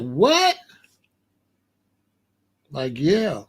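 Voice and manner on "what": mock-stunned, a sharp high rising what. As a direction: replay the moment it shocked you. Retold reaction to mason jars.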